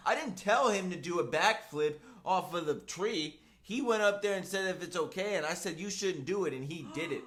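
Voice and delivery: loud, high-pitched indignant self-defence, retold; imitating angry hothead. Fired kid defending himself over backflip, imitated angry. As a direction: protest heatedly that it's not your fault. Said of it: "He's all hot-headed, angry".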